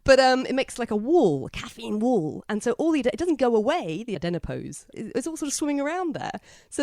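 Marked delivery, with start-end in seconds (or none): none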